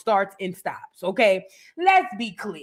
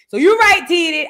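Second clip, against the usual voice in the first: loud agreement; 6 semitones higher, 7 dB louder than usual. Real, not acted. Conceding loudly to co-host T D, loud burst.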